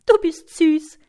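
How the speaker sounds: put-on high, loud, amused girl's voice; giggly. A narrator's reading. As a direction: chirp it with a hint of a giggle. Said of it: "kichert das Ungeheuer".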